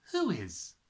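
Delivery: plain reading voice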